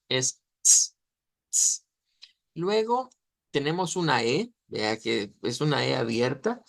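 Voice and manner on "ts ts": "ts" as T released straight into S; affricate TS. Shown as a demonstration. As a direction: demonstrate "ts" as one voiceless affricate. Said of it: "No es solo ts".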